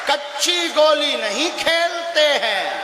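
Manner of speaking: a boastful guru declaring his power, word by word; slow proud proclamation. Real, not acted. (धीमी, ऊँची, गर्वीली घोषणा)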